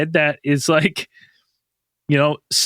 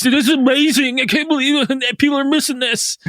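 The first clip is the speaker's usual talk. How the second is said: high-pitched, gushing wonder; awestruck; 8 semitones higher than usual. Retold or imitated. Newcomer sitting under northern lights, awestruck. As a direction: marvel at it, pitched well up.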